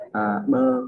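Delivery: high pitched guessing "bơ from"; mock bluffing read. Shown as a demonstration. Tutor demonstrating bluffing unknown word, high and playful. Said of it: "cứ đọc kiểu".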